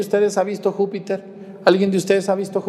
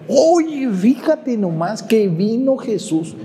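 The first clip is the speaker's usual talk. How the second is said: excited apostle; 7 semitones higher, much slower than usual. Retold or imitated. The apostles telling Tomás Jesus came, much higher and excited. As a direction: burst out with the big news. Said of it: "le platicaron alegres".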